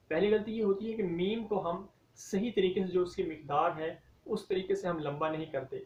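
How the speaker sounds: normal talking voice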